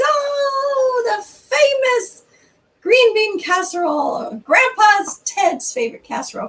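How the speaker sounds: gushing family. Retold or imitated. Family gushing over the casserole at Thanksgiving, high excited praise. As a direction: coo over the famous casserole.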